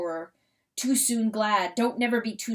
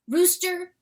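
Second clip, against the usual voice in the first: usual speaking voice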